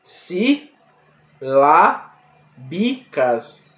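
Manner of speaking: "Silábicas" drawn out very slowly, si-lá-bi-cas, each syllable separate; split into syllables. Shown as a demonstration. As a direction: stretch "Silábicas" into four slow, distinct syllables. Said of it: "Veja, silábicas". ("Silábicas" bem devagar, separando si-lá-bi-cas)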